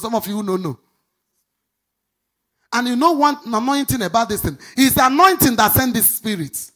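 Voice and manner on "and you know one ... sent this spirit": loud, high, half-sung preaching; preaching at full force. Acted. Preacher launching into her testimony, loud and sing-song. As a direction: lift the crowd with the story's opening.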